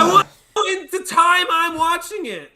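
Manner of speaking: loud, high indignant protest; indignant outburst; exasperated. Real, not acted. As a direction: push the voice up in outrage at a spoiler. Protests being spoiled.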